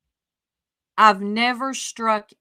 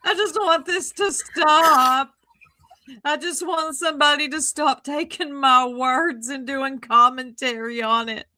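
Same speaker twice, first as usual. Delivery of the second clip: mocking imitation; 5 semitones higher, 3 dB louder than usual. Critic mocks Julie's crying plea, high whiny parody. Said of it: "it's Julie".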